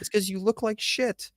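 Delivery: high, slow, put-on sneer; mocking voice. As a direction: jeer at him in a silly voice. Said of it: "I'm like".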